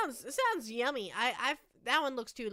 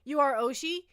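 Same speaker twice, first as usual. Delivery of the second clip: ordinary talk, nothing marked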